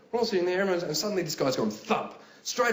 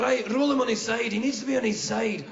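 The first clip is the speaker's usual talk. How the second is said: high, urgent Scottish bystander; pressing Scottish-accented helper; 7 semitones higher, 3 dB louder than usual. Acted. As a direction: give orders in a raised Scottish voice. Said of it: "I clearly have one Scottish accent in me".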